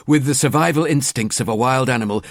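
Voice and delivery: usual speaking voice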